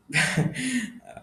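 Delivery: short high self-mocking laugh; real laugh. Real, not acted. Teacher confessing laziness to viewers, a high laugh. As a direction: laugh at your own laziness. Admits being lazy, laughs.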